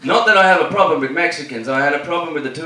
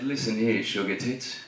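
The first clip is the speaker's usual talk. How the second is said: Mel Gibson quote. Retold or imitated. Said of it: "my best Mel Gibson quote".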